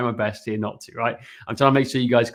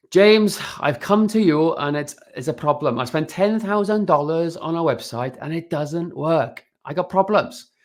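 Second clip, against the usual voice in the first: hammed-up Welsh accent, pitched up; 5 semitones higher than usual. Shown as a demonstration. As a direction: lilt it like a Welsh client with a problem. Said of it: "ask you in a Welsh accent".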